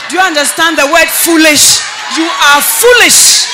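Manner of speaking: high, shouted telling-off, slowing on the last words; loud scolding. Retold or imitated. Mentor rebuking a young man who broke up, shouted scolding. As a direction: shout it so the word foolish lands. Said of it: "I've never heard you speak like that".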